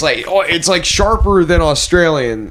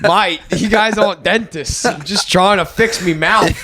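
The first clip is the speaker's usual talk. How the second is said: slow, hammed-up Aussie accent; mock Australian accent; 5 semitones higher than usual. Aussie patient complaining to co-host, slow mock Australian accent. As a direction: stretch it out in a joking Australian accent. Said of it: "What other Australian stereotypes do we know?".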